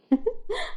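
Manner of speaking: soft, high teasing laugh; short light giggle; playful giggle. A narrator's reading. Malak teasing Hossam on the phone, giggling.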